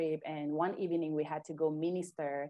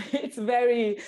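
laughing through words; 8 semitones higher, 8 dB louder than usual. Real, not acted. Still laughing about falling in church.

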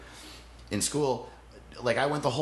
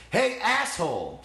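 yelling at the dad; 11 semitones higher than usual. Retold or imitated. Best friend yelling at the girlfriend's dad to distract him, high slow yell. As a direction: drag out a bold insult at an adult. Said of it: "Just yells that at him".

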